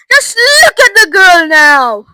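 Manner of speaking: high, loud, drawn-out outburst; indignant cry. A narrator's reading. Mother showing Wonka her changed girl, crying out loudly. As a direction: cry out aghast. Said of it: "cried Missus Beauregard".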